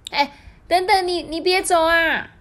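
high, loud cry of stop; urgent high call; calling after someone. Acted. Character calls after departing figure.

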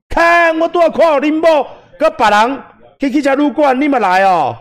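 mocking Taiwanese greeting shouted high. Shown as a demonstration. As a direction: shout a nasty hello across the room. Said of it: "类似这样子".

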